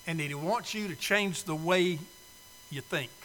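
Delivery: usual speaking voice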